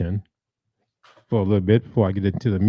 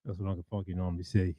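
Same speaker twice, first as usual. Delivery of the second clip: usual speaking voice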